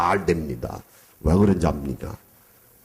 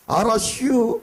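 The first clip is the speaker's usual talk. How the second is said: high drawn-out "알았슈" in Chungcheong dialect; Chungcheong drawl; 13 semitones higher, slower than usual. Retold or imitated. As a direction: imitate a lazy Chungcheong yes. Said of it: "충청도 사람". (충청도 사투리 흉내)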